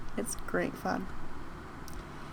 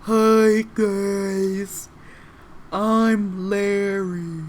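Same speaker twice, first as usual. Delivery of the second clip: drawn-out, awkward quiet-spoken character voice; Larry; 5 dB louder, much slower than usual. Shown as a demonstration. Game master's loaf NPC introducing himself, slow put-on voice. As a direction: stretch it out like a slow loaf, slightly raised pitch. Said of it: "quiet spoken one".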